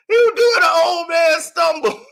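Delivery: laughing shout. Real, not acted. Mocking the fall, laughing.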